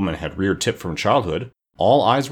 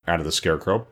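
usual speaking voice

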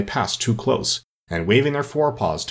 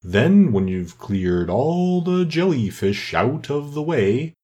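slow, swooping turtle character; excited Mock Turtle; 6 semitones higher, much slower than usual. A narrator's reading. Mock Turtle explaining the quadrille to Alice, excited slow drawl. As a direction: stretch the words out with big swoops.

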